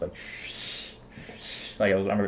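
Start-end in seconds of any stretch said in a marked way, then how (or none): 0.1-0.9 "shh" as a long unvoiced hiss; over-pronounced SH. Shown as a demonstration. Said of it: "very pronounced". 1.4-1.8 "shh" held as a voiceless stream of air; over-pronounced SH. Shown as a demonstration. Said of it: "very pronounced".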